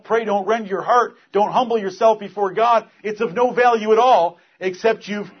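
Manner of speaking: loud, high, impassioned preacher; fiery preaching. Acted. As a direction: thunder it from the pulpit. Preaching at full force.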